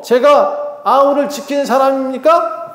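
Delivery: high, drawn-out challenge rising at the end; acted indignant protest; defiant back-talk. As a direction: throw the question back insolently. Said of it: "하고 대들어요".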